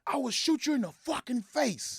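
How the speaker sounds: high, barked threat; police threat. Retold or imitated. Police threatening Bot at gunpoint, barked threat. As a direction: threaten to shoot like a cop.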